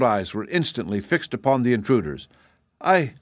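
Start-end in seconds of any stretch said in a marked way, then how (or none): 2.8-3.1 flustered, higher-pitched stammer; confused girl, halting after the first word. A narrator's reading. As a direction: stumble into an embarrassed apology. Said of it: "stammered Betsy, blushing and confused".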